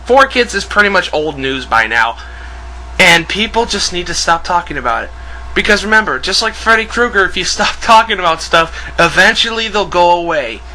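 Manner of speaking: usual speaking voice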